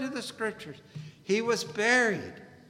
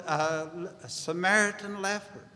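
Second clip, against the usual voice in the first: ordinary delivery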